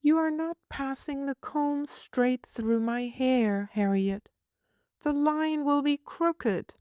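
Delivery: put-on high, prim little girl asking. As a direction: voice a fussy small girl, pitched high. Child voice.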